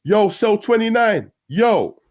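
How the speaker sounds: shouting out. A narrator's reading. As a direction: holler through the window. Neighbour shouting through window.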